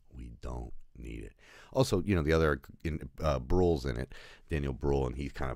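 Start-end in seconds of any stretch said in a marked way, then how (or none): 0.1-1.3 quiet echo. Real, not acted. Repeats the message softer.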